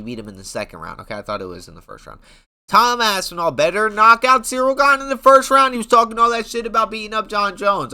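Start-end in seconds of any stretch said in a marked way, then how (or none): 2.7-7.9 loud, high hyped insistence; hyped-up fan yelling a demand, higher and louder; heated demand. Real, not acted. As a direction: pump it up, demanding the knockout.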